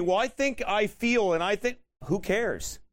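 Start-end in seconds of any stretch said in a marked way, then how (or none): 0.0-1.8 imitating a whiny excuse-maker; lightly mock their feeble excuse. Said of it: "I love how people tell me".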